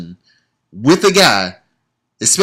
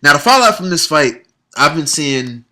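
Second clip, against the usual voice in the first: normal talking voice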